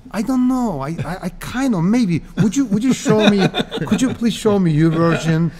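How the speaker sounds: mock-unsure, then asking nicely; humble uncertainty. Magician asked about a trick he knows, playing humble. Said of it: "Don't be a wise guy".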